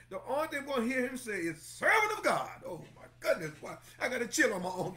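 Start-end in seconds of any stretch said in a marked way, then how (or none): 1.6-2.6 exultant high call of praise; high call of God. Pretend. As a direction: pitch jumps up high on Servant. Preacher voicing God's praise.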